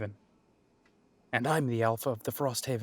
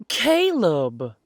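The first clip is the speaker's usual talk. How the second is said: loud, high, joyful call of the name; light up at an old friend; delighted greeting; 4 semitones higher, 10 dB louder than usual. Acted. Barista greets his old friend, loud and delighted.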